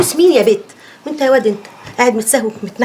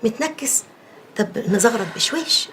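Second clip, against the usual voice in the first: hushed; 3 semitones lower, 6 dB quieter than usual. Acted. Whisper ululation compromise.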